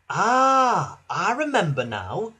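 suddenly remember, lifting the pitch; lively recognition. A narrator's reading. Bertie recognises Thomas.

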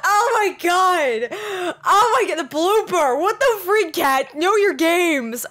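loud, high wail at own mistake; high, stretched cries of disbelief; exasperated outburst. Real, not acted. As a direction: scold yourself at full voice, half laughing. Berates self over blooper, after laughing.

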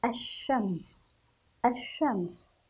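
"الشمس" as ash-shams, no l, long sh; silent lam. Shown as a demonstration. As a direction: stretch "الشمس" with a doubled sh. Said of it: "لم نذكر اللام". (نطق "الشمس" دون لام وبشين مشددة)

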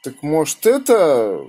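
usual speaking voice

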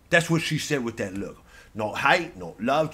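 ordinary talk, nothing marked

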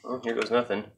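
normal talking voice

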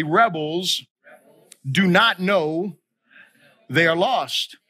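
slow, broken into short phrases with pauses; feed the line in pieces to be repeated; call-and-response. Shown as a demonstration. Preacher asking congregation to repeat after him, slow phrase-by-phrase. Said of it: "repeat after me is this".

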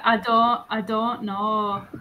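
drawn-out northern English "don't know"; northern English accent. Shown as a demonstration. Showing a learner how northerners say it, northern English accent. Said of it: "they say, like".

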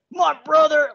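high, loud, drawn-out wrestler voice; hyped wrestler voice; Macho Man voice. Retold or imitated. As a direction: boom it out like a wrestling promo. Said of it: "gonna do a bad Macho Man impression".

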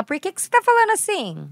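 lightly voiced little sister asking why; little sister quoted.

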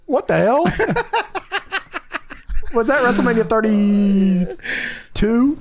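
normal talking voice

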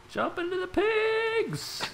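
very high, slow cry; high excited shout. Acted. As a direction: squeal it out gleefully. Cheering the jump.

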